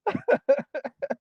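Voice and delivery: high burst of laughter; laughing. Real, not acted. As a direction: crack up, really amused. Amused by Albert's comment.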